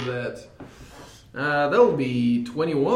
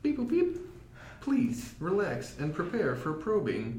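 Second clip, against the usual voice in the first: toy robot voice; 7 dB quieter than usual. Acted. Robot medic line.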